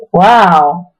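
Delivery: low, dry wow; amazed. Real, not acted. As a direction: react to the scene. Narrator's own aside.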